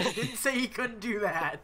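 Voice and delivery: laughing through the words. Real, not acted. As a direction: giggle while speaking. Laughing while teasing.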